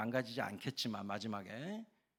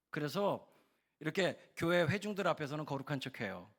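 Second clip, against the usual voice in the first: ordinary talk, nothing marked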